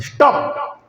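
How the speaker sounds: high unvoiced stop, changed voice; sharp command. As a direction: halt the servant at once. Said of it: "Jagannath Babaji".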